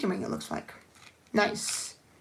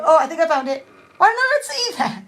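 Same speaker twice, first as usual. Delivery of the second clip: relieved surprise; 5 semitones higher, 7 dB louder, faster than usual. Real, not acted. Finds the lost bow.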